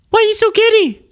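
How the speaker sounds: squeakily high incredulous question; escalating disbelief. Real, not acted. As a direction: push the disbelief right up into a squeal.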